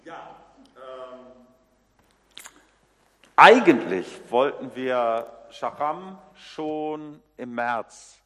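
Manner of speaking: usual speaking voice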